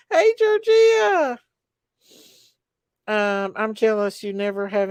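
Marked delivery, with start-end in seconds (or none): 0.1-1.4 high, slow, stretched-out hey; playful put-on voice. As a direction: drawl her name out in a silly voice. Playful voice greeting viewer.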